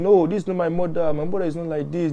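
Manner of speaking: lightly acted child's denial; retold crying protest. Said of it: "the baby start crying".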